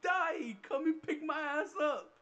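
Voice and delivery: high, drawn-out mock wail; retold desperate plea. Son begging mom to fetch him, mock wail. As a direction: imitate a kid wailing for mom, hammed up.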